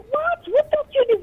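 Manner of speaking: ordinary delivery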